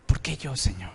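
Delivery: hushed question. Retold or imitated. Believer asking the Lord, hushed and wondering. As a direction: ask it under your breath. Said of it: "Yo me lo he preguntado".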